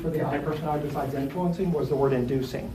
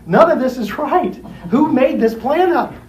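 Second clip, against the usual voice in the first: higher, louder, slow indignant complaint; grumbling complaint; 8 semitones higher, 4 dB louder than usual. Acted. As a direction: gripe about the plan like a fed-up marcher. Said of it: "this person's thinking".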